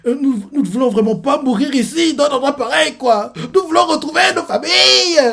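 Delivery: voix aiguë et plus forte, larmoyante imitée; larmes aux yeux. Retold or imitated. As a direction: supplier ses compagnons, au bord des larmes pour de faux. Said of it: "Il dit ça, les larmes aux yeux". (prisonnier suppliant ses camarades, voix larmoyante imitée)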